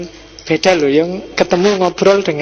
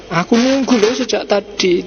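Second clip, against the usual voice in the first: higher-pitched, mildly cross complaint; reproachful demo of a text message; angry reading; 4 semitones higher than usual. Shown as a demonstration. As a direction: demonstrate reading it with annoyance. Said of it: "Itu bisa marah bacanya".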